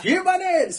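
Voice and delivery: squealed catchphrase, very high; high-pitched shout. Pretend.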